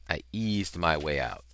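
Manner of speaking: ordinary delivery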